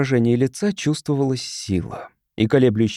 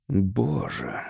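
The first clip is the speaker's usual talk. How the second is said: softer, lower, rasped out; hoarse gasp; 6 semitones lower, 6 dB quieter than usual. Acted. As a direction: choke out the word in shock. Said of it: "хрипло выдавил Дон Мартин".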